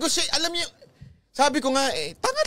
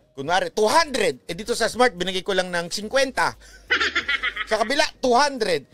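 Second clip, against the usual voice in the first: normal talking voice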